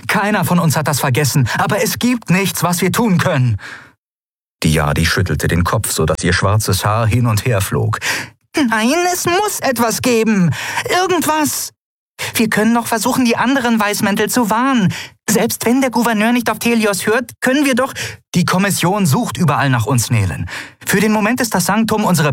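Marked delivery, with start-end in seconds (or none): 0.1-3.6 strict, high-pitched reasoning; firm character voice, raised in pitch; stern. A narrator's reading. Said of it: "rief Endriel streng". 8.6-17.9 very high, urgent little fairy voice; insistent, high-pitched pixie; small winged girl, agitated. Acted.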